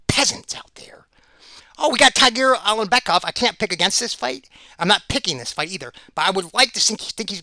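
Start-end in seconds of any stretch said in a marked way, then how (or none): 0.1-1.1 slow breathy whisper; slow mocking whisper; whispered aside. Muttered aside after peasants remark.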